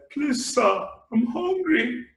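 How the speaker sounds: a hungry woman begging at a car window, high and slow with a laugh. Acted. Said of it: "She was like".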